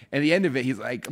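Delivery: ordinary talk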